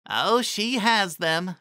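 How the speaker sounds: high, slow knowing pony voice; Trixie's voice. A narrator's reading. Narrator voicing Trixie confirming Raindrops' anger, high and slow.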